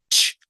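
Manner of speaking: "Ch" as one sharp voiceless burst; isolated loud "tch" for "Ch"; CH sound. Shown as a demonstration. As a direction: let "Ch" go with no vowel. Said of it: "El sonido siguiente es así".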